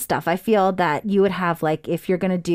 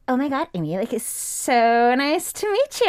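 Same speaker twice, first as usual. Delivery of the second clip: put-on Valley Girl voice; 3 semitones higher than usual. Shown as a demonstration. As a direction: gush like an annoying Valley Girl. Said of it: "really annoying Valley Girl voice".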